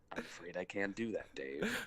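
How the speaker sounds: calm, quiet computer voice; HAL computer voice. Retold or imitated. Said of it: "just for that narrator guy".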